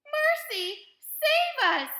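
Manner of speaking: high, loud cry for help; panicked mother yelling; yelled. A narrator's reading. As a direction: yell it out in panic. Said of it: "yelled Missus Beauregard".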